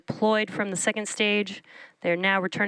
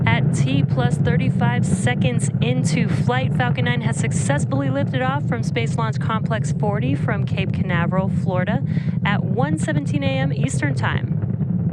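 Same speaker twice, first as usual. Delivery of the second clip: raised voice over the rocket roar; projecting loudly over engine noise; 5 semitones higher, 6 dB louder than usual. Real, not acted. Host speaks over roaring liftoff.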